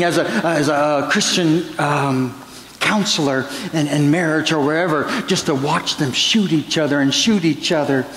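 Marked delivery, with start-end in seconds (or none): none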